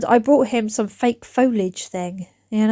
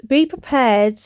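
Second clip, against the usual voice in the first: ordinary delivery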